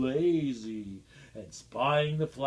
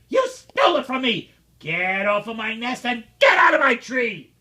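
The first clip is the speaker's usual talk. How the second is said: higher, louder fast furious yell; angry shout; 5 semitones higher, 8 dB louder than usual. Acted. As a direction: chase him off the nest. Angry bird orders Horton away.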